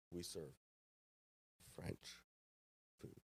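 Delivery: loom over them with long, ominous pauses; imagined stern boss. Retold or imitated. Imagined head chef questioning his cook, towering, quiet menace. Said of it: "towering over me".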